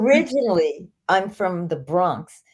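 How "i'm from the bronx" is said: slow, thick Bronx accent. Shown as a demonstration. Guest showing how she used to talk, in a slow Bronx accent. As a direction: lean into the old Bronx sound. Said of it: "I used to talk like this".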